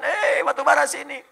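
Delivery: high, loud beckoning call; calling from afar. Acted. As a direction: hail someone across a distance. Coal imagined calling out from afar.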